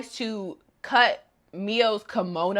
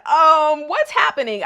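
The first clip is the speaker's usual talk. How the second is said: confused; 7 semitones higher, 7 dB louder than usual. Retold or imitated. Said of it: "So you're just like".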